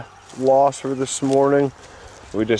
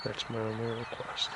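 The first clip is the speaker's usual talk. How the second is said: hushed hunter's whisper; wildlife watching whisper; 3 semitones lower, 21 dB quieter than usual. Real, not acted. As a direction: keep it low so the bird won't hear. Whispering while hunting a bird.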